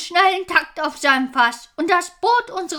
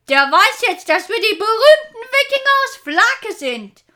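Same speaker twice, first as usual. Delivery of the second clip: proud boast; 8 semitones higher, 6 dB louder than usual. Acted. Vikings boasting after enemy retreat.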